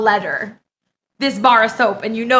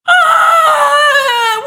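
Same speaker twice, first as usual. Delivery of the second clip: long, high, loud squeal; delighted scream; 9 semitones higher, 6 dB louder than usual. Real, not acted. Streamer seeing a gift soap, delighted scream. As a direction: scream with joy, pitched way up.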